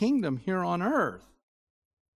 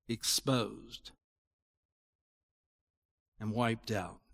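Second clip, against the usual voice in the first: solemn hushed preaching; 7 semitones lower than usual. Acted.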